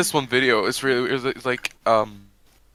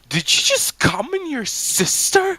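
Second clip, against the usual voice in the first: high, scandalised squeal; mock shocked question. Imitating a clip's shocked onlooker, high mock shock. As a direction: pitch it way up in mock disbelief. Acts out shocked video character.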